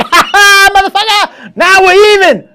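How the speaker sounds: high, loud mocking cackle; gloating taunt. Woman taunting the stung man, loud high gloating. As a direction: crow over him, revenge done.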